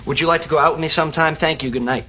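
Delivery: fast, loud, all in one breath; rushed nervous blurt. Acted. Nervously asking the woman out in one rush. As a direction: race through the line to get it over. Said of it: "what I wanted to say was".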